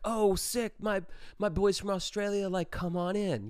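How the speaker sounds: high-pitched, imitating a hyped frat guy; a mock bro welcoming them; excited frat guy.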